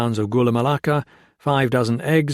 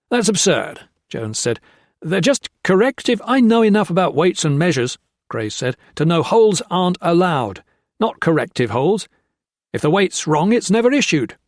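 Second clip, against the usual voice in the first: higher, louder, insistent back and forth; heated argument; 7 semitones higher, 4 dB louder than usual. A narrator's reading. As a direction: push back hard. Heated argument over weights.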